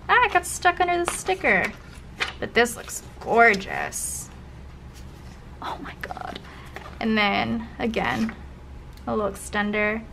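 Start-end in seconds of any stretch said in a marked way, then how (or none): 5.6-6.4 breathless whispered gasp; hushed amazement; awed whisper. Real, not acted. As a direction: whisper it, stunned by the page. Gasping at a gorgeous spread.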